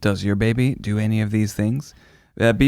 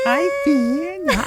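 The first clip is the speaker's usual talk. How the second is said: put-on much higher, sweet toddler greeting; dad echoing his son's greeting, in a high child voice; little boy voice; much slower than usual. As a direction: squeak it like a tiny kid.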